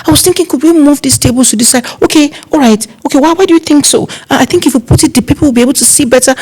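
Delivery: high, wheedling request; pleading uncle. Retold or imitated. Uncle coaxing the planner to move tables, wheedling. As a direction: coax the planner. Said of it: "plead with you".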